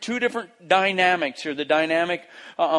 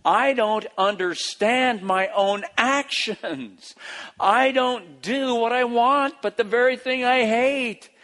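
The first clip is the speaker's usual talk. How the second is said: slow, raised, wrestling with himself; anguished frustration; 5 semitones higher, slower than usual. Acted. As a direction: struggle aloud, baffled by your own failings. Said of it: "how miserable he feels".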